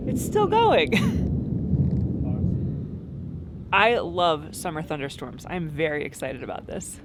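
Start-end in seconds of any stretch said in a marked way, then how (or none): 0.1-3.7 much higher, thrilled it keeps going; delighted squeal. Real, not acted. Thrilled that the thunder keeps rolling, squealing. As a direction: squeal with glee at the storm.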